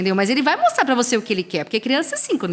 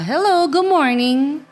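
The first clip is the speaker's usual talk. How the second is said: higher, louder, very slow sing-song hello; cheerful teacher greeting; 6 semitones higher, 4 dB louder, much slower than usual. Acted. Teacher greeting young pupils at arrival, slow sing-song. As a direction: greet the class like a bright morning teacher. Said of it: "A entonação que eu utilizo".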